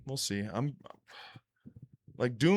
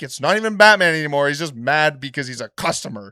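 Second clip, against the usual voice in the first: louder, somewhat higher rant; mock Joker rant; 3 semitones higher, 8 dB louder than usual. Joker ranting at Bruce, loud and raised. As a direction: complain like an angry customer. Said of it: "And he's like".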